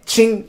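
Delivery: high-pitched "ching" of a blade; quick high metallic sound effect; imitated blade sound. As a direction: make the stab ring out high. Imitates stab noise in retelling.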